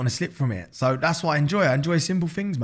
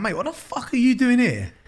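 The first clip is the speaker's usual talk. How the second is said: higher, mock-startled mate calling out; surprised stranger; 9 semitones higher than usual. A passer-by on the street surprised to see him, high and startled. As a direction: act amazed to see him there. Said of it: "people going".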